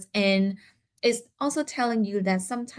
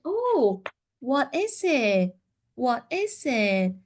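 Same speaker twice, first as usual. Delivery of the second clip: high, slow, coaxing "what is it?"; coaxing question to child; 3 semitones higher, slower than usual. Shown as a demonstration. Therapist slowly coaxing a child to name the picture. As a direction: ask slowly and brightly, drawing out "is". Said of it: "when we are asking".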